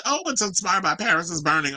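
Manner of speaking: high-pitched gushing imitation; mock hype. Vlogger mocking the hype team around [name], mock hype.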